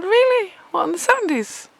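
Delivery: high, loud mock disbelief; incredulous. Replying to the neighbour, high and incredulous. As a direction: exaggerate the disbelief.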